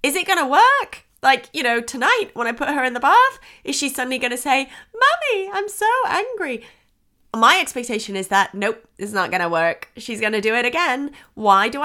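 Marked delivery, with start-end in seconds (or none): 5.0-6.7 high-pitched imitation of a little child; imitated child. Acting her small child saying it.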